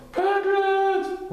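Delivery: high, loud call; shouting. A narrator's reading. Pooh shouting desperately for his missing friend, loud and high. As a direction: yell for Piglet with all your lungs. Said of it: "shouted at the top of his lungs".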